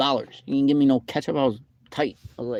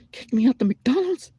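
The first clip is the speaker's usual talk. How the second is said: much higher and louder, rising; squeaky outrage; 13 semitones higher, 5 dB louder than usual. Real, not acted. Venting about being kicked out, high squeak of outrage. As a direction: squeak it out in disbelief.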